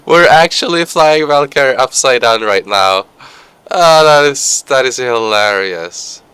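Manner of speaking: high, loud words through laughter; laughing hard. Real, not acted. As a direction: crack up while you talk. Laughing at the upside-down flight.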